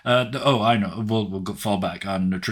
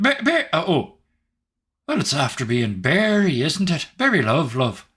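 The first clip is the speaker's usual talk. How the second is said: high, stammering Irish brogue; flustered Irish voice; 7 semitones higher, 3 dB louder than usual. Acted. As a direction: caught off guard, stumbling over his name.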